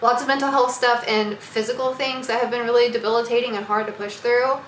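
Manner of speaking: ordinary talk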